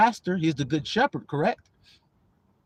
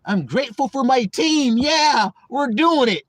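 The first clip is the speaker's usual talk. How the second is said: mock peppy cheer, rising higher; wholesome team cheer; 5 semitones higher than usual. Offended teammate showing the nicer wording, mock peppy. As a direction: imitate a squeaky-clean teammate cheering. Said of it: "Say something like that".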